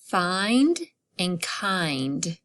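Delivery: Find and kind stretched out slowly; slow demo words; slow example words. Shown as a demonstration. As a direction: drag each word for listening. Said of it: "what about in hut".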